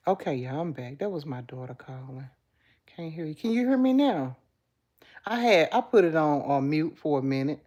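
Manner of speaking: ordinary delivery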